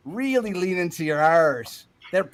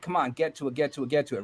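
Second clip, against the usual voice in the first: quick-fire repeats, a bit softer; hurried Californian; 4 semitones lower, 6 dB quieter, faster than usual. Acted. Acting out Californians hurrying someone along, hurried. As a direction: rattle it off impatiently, run together. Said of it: "California, we're like".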